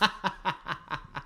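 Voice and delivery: helpless breathy laughter; breathy giggling laugh. Real, not acted. Co-host laughing at his jab at the host, giggling. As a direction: laugh out at your own joke.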